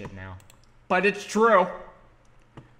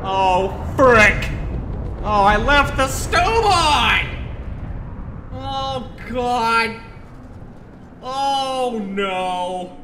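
high, drawn-out panicked wailing; hammed-up dismay; 9 semitones higher, 8 dB louder, much slower than usual. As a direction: wail each word over the stove disaster. Stove disaster unfolding in game.

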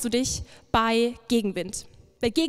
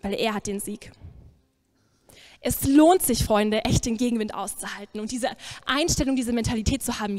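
normal talking voice

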